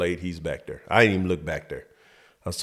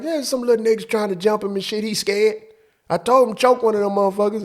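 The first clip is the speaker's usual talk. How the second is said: high, louder mock stepdad; hammed-up stepfather boasting; 13 semitones higher, 3 dB louder than usual. Stepfather telling his friend the boy is scared, high mock voice.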